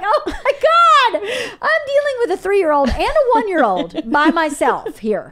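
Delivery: high, laughing disbelief at the chaos; overwhelmed mom cracking up; laughing exasperation. Retold or imitated. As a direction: laugh it off like you're at your wits' end. Exasperated mother retelling phone call.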